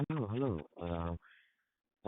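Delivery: usual speaking voice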